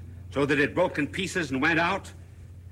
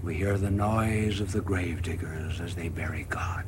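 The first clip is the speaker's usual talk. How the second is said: grim whisper; 5 semitones lower, 3 dB quieter than usual. Acted. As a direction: whisper like hearing graves dug. Madman's grim vision whispered.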